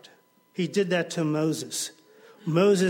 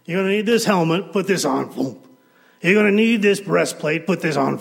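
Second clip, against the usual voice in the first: hearty King Saul; 9 dB louder, faster than usual. Acted. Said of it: "he brings David in".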